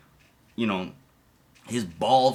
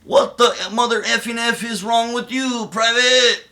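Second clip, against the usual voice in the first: higher, louder mock sergeant yell; drill sergeant yell; 9 semitones higher, 6 dB louder, slower than usual. Drill sergeant calling out Poop Frog in the ranks, mock yell. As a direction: chew out the private. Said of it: "He says".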